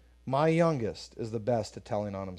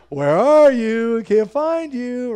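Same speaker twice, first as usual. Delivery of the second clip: slow, much higher, louder pretend seeking; mock searching call; 10 semitones higher, 11 dB louder, slower than usual. Dad playing hide and seek with his new son, slow high pretend call. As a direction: sing it out as if he's truly lost. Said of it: "But I'm still like".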